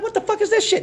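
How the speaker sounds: high, shocked squawk at the cup; outraged disbelief. Retold or imitated. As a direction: yelp it like you can't believe what you're holding. Handed a red cup of liquor.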